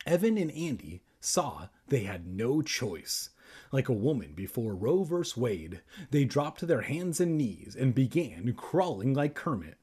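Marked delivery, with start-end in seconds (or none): none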